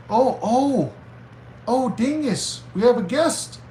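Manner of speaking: high-pitched, thrilled exclamation; excited alert. Real, not acted. Streamer announcing a guest to the dog or chat, excited.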